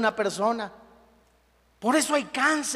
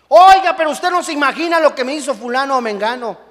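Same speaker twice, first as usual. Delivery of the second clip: louder, higher, indignant griping about someone; aggrieved complaint; 5 semitones higher, 9 dB louder than usual. Retold or imitated. Preacher mimicking a churchgoer's grievance, indignant. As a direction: complain that nobody knows what was done to you.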